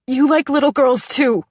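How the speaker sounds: loud, high blurted accusation; blurting. Acted. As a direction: blurt it out suddenly. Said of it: "I blurt".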